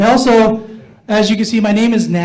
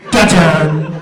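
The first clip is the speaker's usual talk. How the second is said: loud sung dun-dun sound effect; dramatic sting; 6 dB louder than usual. Pretend. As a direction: hit the TV cliffhanger sting. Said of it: "I just think, like".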